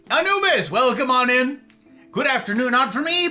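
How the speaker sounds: high loud welcome; excited greeting. Real, not acted. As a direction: call out the welcome.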